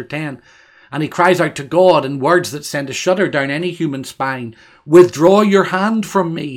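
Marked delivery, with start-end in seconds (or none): 4.9-6.6 a slow, louder cry, higher than the reading. Acted. Preacher reads Job crying out to God, a slow pleading cry. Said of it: "he cries in Job thirteen twenty-one".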